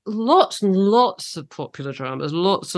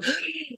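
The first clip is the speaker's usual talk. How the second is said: a sharp, high imitating gasp under the gas mask; mock gasp; 10 semitones higher, 10 dB quieter than usual. Host acting out the dentist's gas mask, a mock gasp. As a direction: suck the air in like the mask is going on. Acting being gassed as a child.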